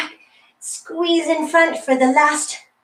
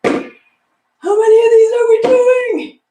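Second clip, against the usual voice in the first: high, loud, strained plea; loud complaining call; 9 semitones higher, 6 dB louder, slower than usual. Real, not acted. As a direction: cry out how many left. Exhausted participant calls out.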